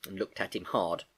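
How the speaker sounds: plain reading voice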